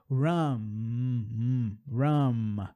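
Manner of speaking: rum and hum held on a humming M with lips shut; closed M. Shown as a demonstration. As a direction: hum the M at the end. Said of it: "terminam com M".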